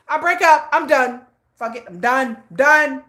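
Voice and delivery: loud, high mock exasperation; impatient quitting. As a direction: declare it over, hammed-up and self-mocking. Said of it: "I give up quickly".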